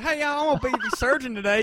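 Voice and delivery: high, loud mock Southern surgeon; thick Alabama accent. Scene: surgeon introduces herself to the patient, thick Alabama accent. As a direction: announce yourself in a hammed-up Alabama accent. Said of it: "She had a really thick Alabama accent".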